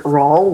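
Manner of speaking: "[name]" in one syllable, rhyming with Paul. Shown as a demonstration. Co-host asking about the name, [name] said rawl. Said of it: "like Paul".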